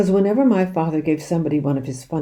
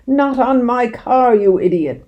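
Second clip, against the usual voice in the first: slow, high, loud furious bellow; 7 semitones higher, 7 dB louder than usual. A narrator's reading. Mister Hazel bellowing at the sergeant, slow loud fury. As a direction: roar at him in outrage. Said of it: "Mister Hazel bellowed".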